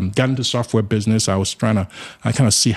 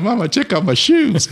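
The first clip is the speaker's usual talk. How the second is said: put-on a much higher, louder, slower boyish voice bursting with excitement; excited boy; 11 semitones higher, 4 dB louder than usual. Boy showing his mama new shoes, high and excited. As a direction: show off the new shoes like a thrilled kid. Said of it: "I said".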